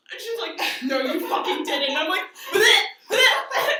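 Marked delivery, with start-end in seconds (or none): 0.9-1.9 loud, disbelieving cry; retold shocked outburst. Sister seeing banana break in throat, loud disbelief. 2.5-3.7 loud, high retching noise; hammed-up gagging, bursting out; imitated choking. Storyteller miming banana stuck in throat, loud gagging.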